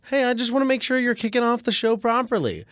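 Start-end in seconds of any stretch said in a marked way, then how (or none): none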